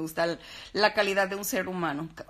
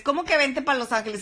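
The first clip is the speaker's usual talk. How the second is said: "vente pa' Los Ángeles" echoed much higher and louder, stress on "que"; mock disbelief; 7 semitones higher, 7 dB louder than usual. Host answering people who invite her to Los Ángeles, mock incredulous. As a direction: throw the phrase back in amused disbelief.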